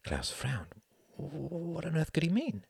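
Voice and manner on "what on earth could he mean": high, puzzled inner voice; bewildered wondering. A narrator's reading. Klaus frowns at the order, bewildered. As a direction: wonder it in bafflement.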